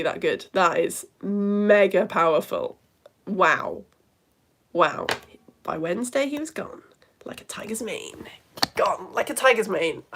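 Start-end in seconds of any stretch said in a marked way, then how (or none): 5.6-8.3 hushed awe; whispered savouring. Real, not acted. Host savouring Edmund's poem line, in a whisper.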